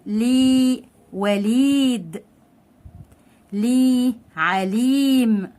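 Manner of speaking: slowly stretched ee in "لي", "وليد", "عليم"; long ya madd. Shown as a demonstration. Teacher reading ya-madd words, drawn-out "لي", "وليد", "عليم". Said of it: "تعالوا نقرأ بقى الكلمات بمد الياء".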